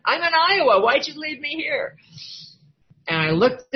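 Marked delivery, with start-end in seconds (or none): 0.0-2.0 high, loud plea to the sky; plaintive cry. Retold or imitated. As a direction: call out like the abandoned little girl. Childhood longing, crying out.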